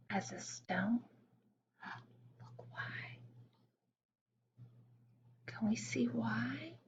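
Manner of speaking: put-on hushed whisper to the children. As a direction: whisper as if not to scare the hare. Hushing kids to watch still hare.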